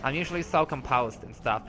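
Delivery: soft, subdued, low apology; quiet, halting contrition; composed apology. Acted. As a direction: pull back sheepishly after an outburst. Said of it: "I got a little bit out of control there".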